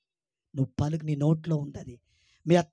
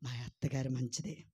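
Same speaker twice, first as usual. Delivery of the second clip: lower, softer model line; soft, lower demo answer; 6 semitones lower, 5 dB quieter than usual. Shown as a demonstration. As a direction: say it gently as the right answer. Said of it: "ఏం పలకాలి?".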